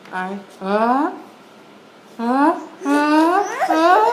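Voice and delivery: a sneeze gathering force; building sneeze. Acted. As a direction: let the sneeze wind up on each uh.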